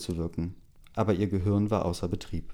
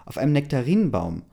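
correcting; 5 semitones higher, 5 dB louder than usual. A narrator's reading. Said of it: "verbesserte sie die Frau".

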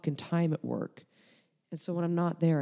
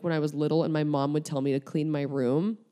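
ordinary talk

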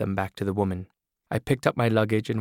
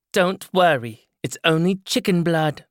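higher, louder brassy woman's voice; loud, higher voice of a tough older woman; brash woman character; 8 semitones higher, 5 dB louder than usual. Acted. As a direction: play the hard-bitten woman with the scissors.

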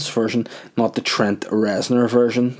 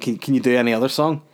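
ordinary delivery

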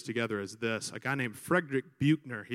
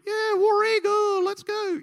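much higher, loud, drawn-out cheer; mock crowd cheer; 6 dB louder, slower than usual. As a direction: whoop it up like rowdy students. Students cheering for their college.

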